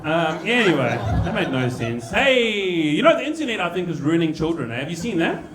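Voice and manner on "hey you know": ordinary talk, nothing marked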